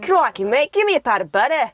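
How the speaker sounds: high, hammed-up Australian; Australian accent. Shown as a demonstration. Sibling repeating the demo on request, exaggerated Australian. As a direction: exclaim it in a cartoon Australian accent. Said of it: "do it again".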